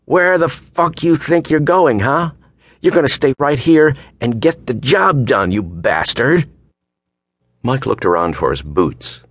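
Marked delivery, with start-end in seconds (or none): 0.1-6.5 high, angry acted screech. A narrator's reading. Woman demanding he stay, screeching angrily. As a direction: pitch it right up and rail at him, short of a full scream. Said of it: "hearing her screeching voice".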